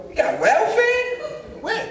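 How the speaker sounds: slow question swooping very high; incredulous. Retold or imitated. Son hearing the family has welfare, incredulous. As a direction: squeal it in disbelief, drawn out.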